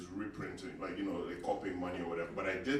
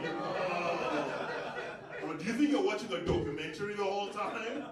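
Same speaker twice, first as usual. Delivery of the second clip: higher, louder, laughing disbelief; exasperated disbelief; 9 semitones higher, 4 dB louder than usual. Retold or imitated. Comedian to fan, laughing exasperated disbelief. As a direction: ask it half-laughing, aghast.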